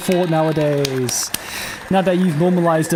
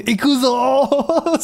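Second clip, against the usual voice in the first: awkward hype call; 8 semitones higher, much slower than usual. Retold or imitated. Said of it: "the awkward pause of him going".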